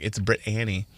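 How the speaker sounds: "Britt Annie" said as two separate words; broken into two names. Shown as a demonstration. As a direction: split it clearly: "Britt", then "Annie". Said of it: "I'd break it up like".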